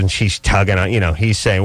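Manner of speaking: ordinary talk, nothing marked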